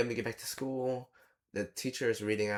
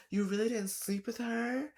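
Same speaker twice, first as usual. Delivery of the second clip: very high, hammed-up girly disbelief; mock surprised girl; 13 semitones higher than usual. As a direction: overplay her amazed, high squeal. Mimics heroine asking again.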